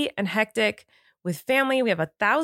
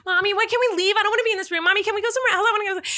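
put-on much higher whiny little-boy voice; toddler voice; 11 semitones higher, much faster than usual. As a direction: beg like a bored toddler stuck in a dressing room, much higher. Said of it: "he would be like".

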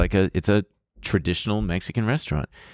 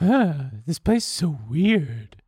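high, airy put-on voice of a bored date; imitating his date's voice; 6 semitones higher, slower than usual. Guy imitating his dinner date at a Mexican restaurant, high mocking voice. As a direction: mimic her exact sound, pitched way up. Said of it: "Not only is this exactly how she sounds".

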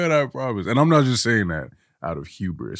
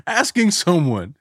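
ordinary delivery

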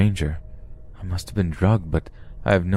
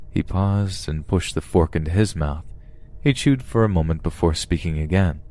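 plain reading voice

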